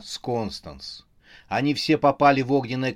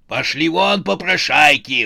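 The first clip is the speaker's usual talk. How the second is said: loud, raised angry yell; furious shout; 4 semitones higher, 8 dB louder than usual. A narrator's reading. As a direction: bellow to drive them away. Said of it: "вскричал он". (яростный крик)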